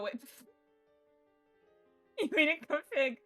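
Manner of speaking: normal talking voice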